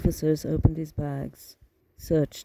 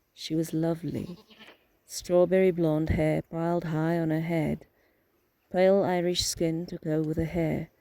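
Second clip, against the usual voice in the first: plain reading voice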